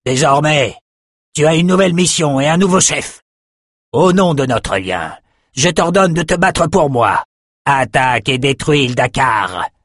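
bien plus haut, il commande avec force; ordre impérieux. Acted. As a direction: exige l'obéissance. Outros commande le dragon. (voix de commandement haute)

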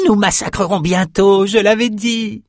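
high, frenzied shaman cry. A narrator's reading. Shaman warns the galley of coming slaughter, crying out. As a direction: shout the prophecy far up in pitch. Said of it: "cria Crieux".

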